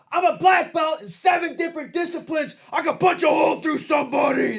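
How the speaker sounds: high, loud yelled challenge; screaming madman impression. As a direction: scream it like an unhinged motivational speaker. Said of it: "he was screaming and yelling".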